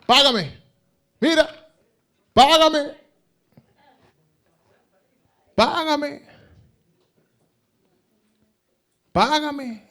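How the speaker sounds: high, loud haughty demand; an arrogant man shouting for payment; arrogant servant. Acted. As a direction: sneer it down at the debtor. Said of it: "Ahora viene el altanero".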